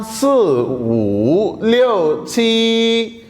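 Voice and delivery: 一 through 七 with deliberate Mandarin tones, 五 dipping far down; Chinese counting with tones. Shown as a demonstration. Teacher revising numbers for students, exaggerated Mandarin tones. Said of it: "अगर मैं इन सभी को इकट्ठा पढ़ूं".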